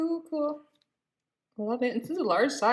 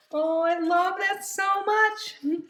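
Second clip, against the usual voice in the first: high, slow, drawn-out adoration; gushing; 9 semitones higher, slower than usual. Real, not acted. Unboxer thrilled by the gorilla adoption, gushing. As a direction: stretch it out high, overcome with delight. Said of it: "or I'll keep gushing".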